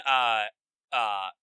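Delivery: ordinary talk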